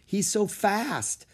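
put-on high, slow young girl. A narrator's reading. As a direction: voice Annie as an eager girl. Annie voiced in story.

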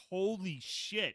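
slow, drawn-out mock awe; retold amazement. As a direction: stretch it out like a stunned gamer. Retold awed reaction to the ending.

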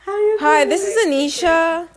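bright phone-greeter hello; customer service voice. Shown as a demonstration. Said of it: "What's your customer service voice?".

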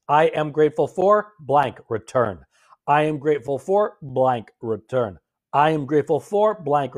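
"for" stressed before "blank"; template read out. Shown as a demonstration. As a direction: dictate "I am grateful for blank" with a lift on "for". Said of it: "three separate entries".

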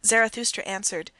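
ordinary delivery

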